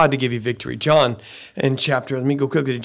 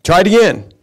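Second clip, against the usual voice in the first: louder insistent command; firm grandpa; 7 dB louder than usual. Retold or imitated. Grandpa telling the grandson to try again, louder. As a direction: repeat the order, firmer. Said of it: "Grandpa says".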